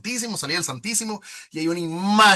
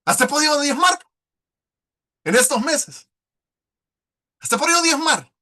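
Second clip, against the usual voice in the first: higher and louder, bursting with joy; excited; 7 semitones higher, 5 dB louder than usual. Real, not acted. Testifying to the congregation, excited and loud. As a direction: proclaim it with delight.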